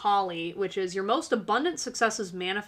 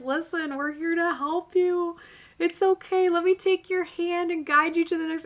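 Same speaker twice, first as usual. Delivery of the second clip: put-on calm her like a frightened child; gently coaxing guide voice; soothing spirit guides; 8 semitones higher than usual. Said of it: "And they're just kinda like".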